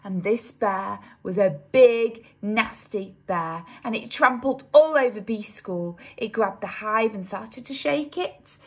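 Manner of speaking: plain reading voice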